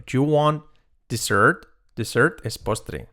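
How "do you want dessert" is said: ordinary talk, nothing marked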